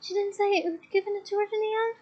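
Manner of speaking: distraught. A narrator's reading. Leanne explaining the necklace to Hermione, distraught. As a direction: spill it out in distress.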